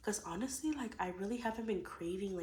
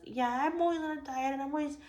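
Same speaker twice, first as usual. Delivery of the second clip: higher, louder, fast mock boast; sing-song mock brag; 7 semitones higher, 5 dB louder, faster than usual. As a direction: parody a smug dieter. Mocking a perfect dieter.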